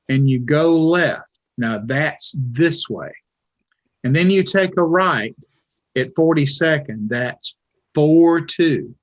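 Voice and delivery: over-explaining doorman. Retold or imitated. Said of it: "told me everything twice".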